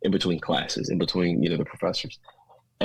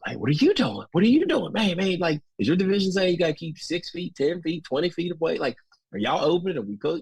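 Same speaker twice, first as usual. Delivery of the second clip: excited principal; 6 semitones higher than usual. Retold or imitated. Frantic principals comparing notes.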